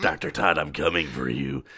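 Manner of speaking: menacing low demon. Retold or imitated. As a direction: growl it like a demon.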